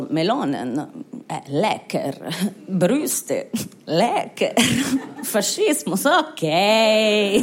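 a lightly voiced dopey Italian man drooling; dim Italian voters. Comic imitating Italian voters won over by melons, dopey and low. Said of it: "die Italiener waren so".

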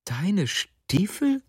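high, slow, disbelieving question; stunned. A narrator's reading. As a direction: echo it slowly in bewilderment. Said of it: "wiederholte die Frau fassungslos".

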